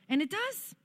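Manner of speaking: high, rising note of surprise; amazed discovery. Real, not acted. Speaker telling church that saying it really helps, high and amazed. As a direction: lift it far up as if amazed. Delighted discovery, then settles to plain.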